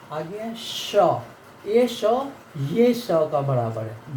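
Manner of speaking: normal talking voice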